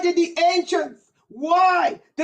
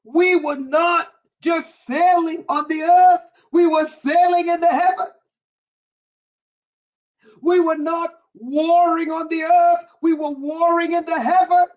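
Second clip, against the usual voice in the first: louder, building sermon cadence; fervent repeated preaching build; 3 dB louder than usual. Acted. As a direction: preach it, rising with each line. Preaching at full force.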